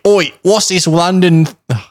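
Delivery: high, slow Cockney, breaking off; botched Cockney accent. Acted. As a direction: try Cockney and stumble on "London". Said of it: "can't say London in a British accent".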